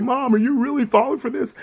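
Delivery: put-on high disbelieving child; incredulous kid. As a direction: plead with mom in disbelief. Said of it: "I'm just like".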